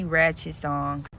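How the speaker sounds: ordinary talk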